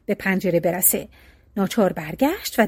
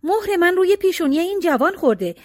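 higher, joyful exclamation; excited cry; 7 semitones higher than usual. A narrator's reading. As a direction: call out in delight at finding him. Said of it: "دختر جوان فریاد زد".

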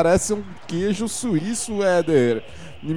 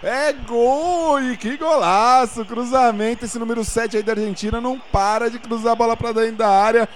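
high, drawn-out stadium goal call; explosive goal commentary; 9 semitones higher, much slower than usual. Acted. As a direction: shout the goal like a live radio narrator.

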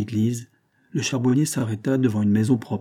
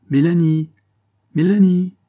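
high, loud summons; raised, carrying shout of a name; calling out; 7 semitones higher, 8 dB louder than usual. A narrator's reading. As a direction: call a name through to another room. Aunt calls her sister from another room.

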